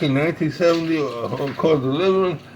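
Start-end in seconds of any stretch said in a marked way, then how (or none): none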